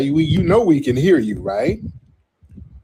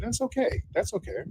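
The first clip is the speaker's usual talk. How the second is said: high, very soft dismissal; condescending reassurance; 8 semitones higher, 12 dB quieter than usual. Real, not acted. Host brushing off an arguing caller, condescending. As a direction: soothe them patronizingly, high and hushed.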